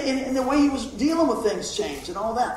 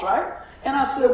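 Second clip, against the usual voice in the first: ordinary delivery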